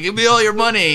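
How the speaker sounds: loud demanding holdup yell; robber shout. Retold or imitated. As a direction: bark it like a robber. Bank robber voiced in bit.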